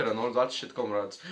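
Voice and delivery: put-on comrades dropped low and soft in a jokey Russian accent; a deep joking Russian comrade tag; deep mock Russian. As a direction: sink into a deep Soviet growl on comrades. Reactor's running Russian joke.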